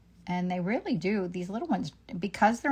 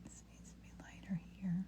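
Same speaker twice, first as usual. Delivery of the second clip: whispering; 13 dB quieter than usual. Real, not acted. Muttering to herself while painting.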